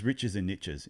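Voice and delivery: "niches" said as "nitches"; American pronunciation. Shown as a demonstration. Host quoting a business saying, "niches" pronounced American-style as "nitches". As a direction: rhyme "niches" with "riches", the American way. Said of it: "If you're in America, that's how they say it".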